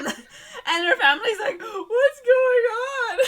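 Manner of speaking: high, loud, laughing through the words; can barely get it out for laughing. Real, not acted. Retelling the attack scene, cracking up high and loud. Family panics, retold laughing.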